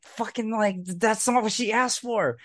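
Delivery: high-pitched, exasperated retelling; retold indignation. Co-host recalling anger at the sister, indignant. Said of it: "I was just so mad".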